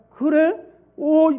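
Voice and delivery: loud, high surprised outburst; startled neighbor. Acted. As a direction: jolt up in shock.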